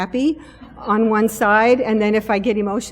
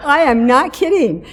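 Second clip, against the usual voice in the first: laughing excitement; 4 semitones higher, 8 dB louder than usual. Real, not acted. Amused retelling of Fitbit story.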